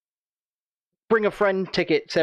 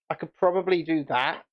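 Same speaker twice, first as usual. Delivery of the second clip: ordinary talk, nothing marked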